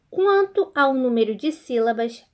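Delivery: normal talking voice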